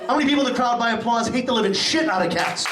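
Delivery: emphatic call for applause, leaning on "hate"; rousing crowd poll. Acted. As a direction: fire up the crowd for a big cheer.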